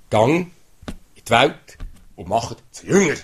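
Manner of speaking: loud, ringing call to go out; proclaiming command. Acted. Preacher booming God's command to the congregation, loudly. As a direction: boom it out like a commission.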